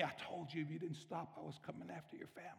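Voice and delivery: the enemy taunting a father, very softly; menacing enemy voice. Acted. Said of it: "I heard that voice say to me".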